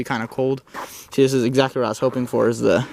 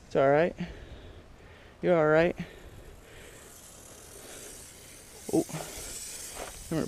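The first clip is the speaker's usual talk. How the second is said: holding down a pet; 10 dB quieter than usual. Real, not acted. Coaxing a snake out of a cactus.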